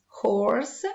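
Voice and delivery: usual speaking voice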